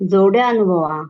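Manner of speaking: ordinary talk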